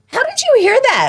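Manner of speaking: put-on high astonished kid voice; surprised kid. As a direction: squeak it like a startled boy. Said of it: "And he'll go".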